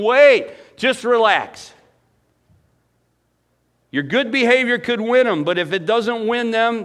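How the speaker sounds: loud, high sermon delivery; fervent preacher; full-force preaching. Acted. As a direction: press it hard on the congregation.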